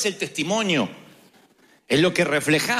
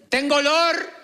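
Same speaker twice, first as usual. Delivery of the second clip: mock confession; 9 semitones higher, 3 dB louder than usual. Mock confession of smelling bad.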